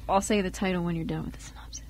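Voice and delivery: whispered aside. Real, not acted. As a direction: whisper so the reader isn't interrupted. Whispering aside before the reading.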